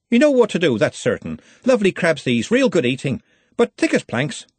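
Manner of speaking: chatty fish seller. A narrator's reading.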